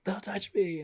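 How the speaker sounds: high mock cry of Ellie; Ellie pushing away. Ellie shoving Joel off, imitated in a high pitched voice. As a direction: lift it high like a distraught girl. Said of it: "she's just like".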